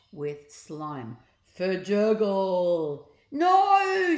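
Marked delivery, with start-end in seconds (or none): 1.6-3.1 slow, louder gloopy creature sound; slime gurgle word. A narrator's reading. 3.3-4.2 very high, loud shout; loud shrill shouted no. A narrator's reading. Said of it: "shouted the pair".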